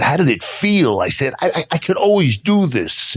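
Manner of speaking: high, mock-boastful reply; cocky retort. Answering Tito Santana's question, high and cocky. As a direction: toss it off like it was easy all along.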